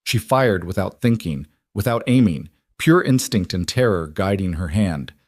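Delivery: plain reading voice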